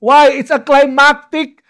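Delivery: high, loud, emphatic proclamation; triumphant pulpit exhortation; full-force preaching. Real, not acted. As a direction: drive each command home to the congregation.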